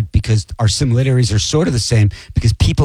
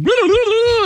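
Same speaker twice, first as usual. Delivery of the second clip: high babbled guitar-solo noise; imitated guitar noodling. Host mimics guest soloing through interview, high babble.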